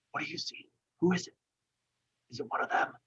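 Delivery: put-on hushed, scared child's whisper from hiding; breathless little whisper; frightened whisper. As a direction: whisper like a kid afraid of being found. Hidden child whispers from fort.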